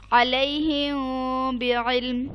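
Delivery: फहकुम बैनहुम recited very slowly, मीम hummed through the nose; slow Quranic chant, nasal ghunna held on मीम; ghunna recitation. Shown as a demonstration. Teacher reciting for her student, slow nasal ghunna on मीम. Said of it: "इसे कहेंगे इख्फा".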